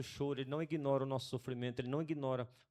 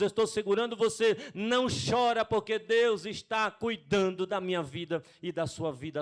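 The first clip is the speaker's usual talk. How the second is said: loud, high fervent preaching; fervent preacher lifting the crowd; preaching at full force; 6 semitones higher, 10 dB louder than usual. Acted. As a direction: build to a rousing shout of comfort.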